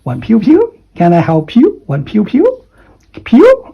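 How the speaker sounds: "Wan piu piu" high and rising, the last "piu" squeaked very high; Cantonese phrase. Shown as a demonstration. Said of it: "Cantonese phrase".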